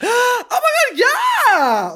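high, loud gasping scream of delight; excited shriek. Real, not acted. Host agreeing to call the fan back, excited shriek. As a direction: squeal an overjoyed yes after a gasp.

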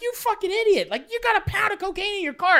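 high-pitched mock rant at a reckless friend; mock scolding. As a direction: berate a dumb friend. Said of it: "We're like".

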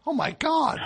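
high mock amazed exclaim; hammy amazement. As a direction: gush with overdone awe. Reacting to ghost banished.

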